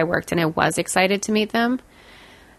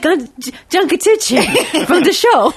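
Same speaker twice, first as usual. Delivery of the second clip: excited fan; 9 semitones higher, 5 dB louder, slower than usual. Retold or imitated. Excitedly naming a celebrity.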